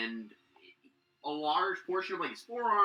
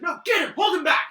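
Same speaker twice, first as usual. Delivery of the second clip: loud, high urgent yells; guards shouting; 16 dB louder than usual. Acted. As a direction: shout to restrain him. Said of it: "you can hear some of the guys like".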